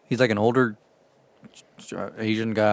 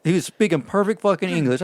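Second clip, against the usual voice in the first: ordinary talk, nothing marked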